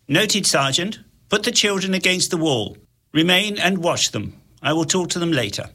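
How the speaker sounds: calm commanding voice; cold Templar. Acted. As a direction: give orders coolly. Templar giving orders.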